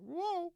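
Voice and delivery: high falling whoa. Retold or imitated. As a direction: yelp it high, then let it drop. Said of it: "He's usually like".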